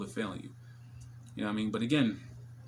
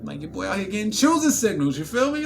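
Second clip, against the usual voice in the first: higher, louder bragging; hyped brag; 9 semitones higher, 9 dB louder than usual. Real, not acted. Him bragging to viewers, hyped. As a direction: crow about it. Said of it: "bragging about signals".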